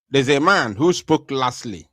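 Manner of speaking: usual speaking voice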